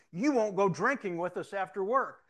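loud, high mock complaint; blurted accusation. Co-worker accusing officer before captain, blurted complaint.

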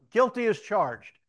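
loud, high, drawn-out declaration; emphatic admission. Retold or imitated. Officer answering his accuser, loud proud admission. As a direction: proclaim it slowly at full volume.

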